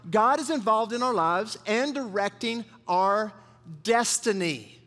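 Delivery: slow, weighty dictation pace; slow and deliberate. Shown as a demonstration. Pastor dictating a key line to the congregation, slow and deliberate. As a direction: say it slowly so they can write it down. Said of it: "good to write down".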